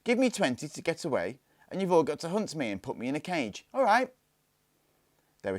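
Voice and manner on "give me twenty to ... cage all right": high, eager call to the others; bright game-starting dare, pitched up; excited challenge. A narrator's reading. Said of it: "she said".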